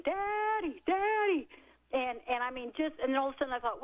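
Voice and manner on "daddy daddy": a kid shrieking for her father, retold; high-pitched retold cry of Daddy; mock childhood scream for daddy.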